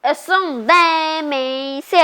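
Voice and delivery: slow loud goofy brag; loud slow boast. Acted. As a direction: drag it out proudly.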